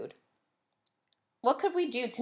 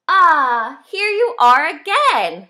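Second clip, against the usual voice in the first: high, slow, welcoming bug; friendly ladybug; 6 semitones higher, 8 dB louder, slower than usual. A narrator's reading. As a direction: greet her kindly, lightly voiced. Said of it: "said the friendly ladybug".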